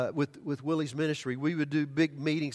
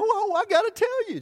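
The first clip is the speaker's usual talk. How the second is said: much higher and louder, gushing; excited lady; 8 dB louder than usual. Retold or imitated. Lady eager to tell the preacher her news, excited and much higher. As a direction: bubble over with eagerness. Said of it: "she was so excited".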